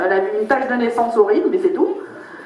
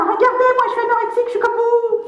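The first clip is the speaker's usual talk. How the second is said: voix de blonde snob aiguë qui parle aux Africains; blonde snob imitée; 11 semitones higher than usual. Retold or imitated. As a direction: imiter une bimbo minaudante, très haut perchée. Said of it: "elle montre aux Africains". (bimbo qui se montre aux Africains, voix haut perchée)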